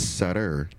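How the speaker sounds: sister said as "sutter", with no first s-t cluster; Milwaukee's "sutter" in place of sister; Milwaukee pronunciation. Shown as a demonstration. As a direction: say "sutter" for sister, rhyming with "brudder". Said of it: "The way we pronounce sister is sutter".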